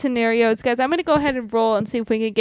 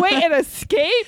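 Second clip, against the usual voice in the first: higher-pitched, hammed-up discovery; mock amazement; 7 semitones higher than usual. Trapped dad spots the open door, mock amazed. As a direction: gasp it out as a trapped dad's realisation.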